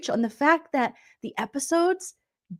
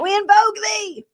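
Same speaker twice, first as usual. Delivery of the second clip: much higher, solemn spell call; mock witch invocation; 10 semitones higher, 4 dB louder than usual. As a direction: intone it like a dramatic witch. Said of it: "she was like this".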